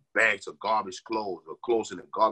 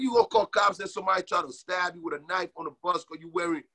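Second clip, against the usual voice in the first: ordinary talk, nothing marked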